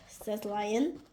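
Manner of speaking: ordinary delivery, nothing marked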